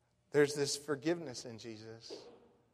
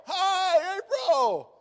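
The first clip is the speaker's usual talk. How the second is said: high, loud, drawn-out group hello; class greeting chorus; 7 dB louder, much slower than usual. Retold or imitated. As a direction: imitate a whole class calling out together. Imitates whole class greeting caller.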